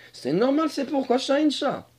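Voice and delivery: high put-on creature voice; high-pitched dragon stating the obvious; comic dragon character. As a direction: reason nonsensically in a high dragon voice. Dragon figure explains.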